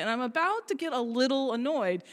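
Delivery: normal talking voice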